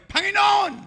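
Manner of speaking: pleading cry. Acted. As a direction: beg to be remembered. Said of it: "ang sigaw niya".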